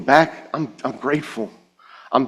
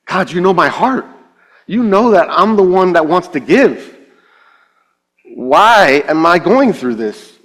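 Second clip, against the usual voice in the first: raised, swooping exasperated plea; frustrated prayer; 6 semitones higher, 3 dB louder than usual. Retold or imitated. Church leader questioning God at breaking point, frustrated. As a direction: plead with God, fed up. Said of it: "fed up and frustrated".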